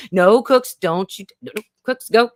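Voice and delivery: sharp order to a misbehaving pet; real scolding interruption. Real, not acted. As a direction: break off to shoo a pet away firmly.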